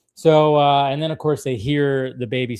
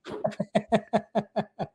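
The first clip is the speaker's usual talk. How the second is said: high light laughter; laughing; 8 semitones higher, 5 dB quieter than usual. Real, not acted. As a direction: burst out laughing. Laughing at the joke.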